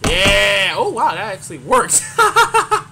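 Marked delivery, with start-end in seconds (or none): 2.2-2.8 high squeaky laugh. Real, not acted. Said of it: "sound like [name] when she was laughing".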